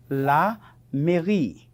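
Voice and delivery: la mairie drawn out slowly; slow pronunciation model. Shown as a demonstration. Teacher models the French answer.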